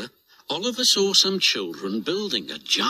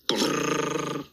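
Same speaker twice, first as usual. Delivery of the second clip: shivering brrr; 2 semitones higher than usual. Acted. Shivering from cold.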